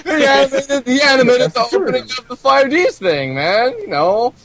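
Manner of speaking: high, laughing excited voice; gushing fan, laughing. Retold or imitated. Excited fan voicing recognition.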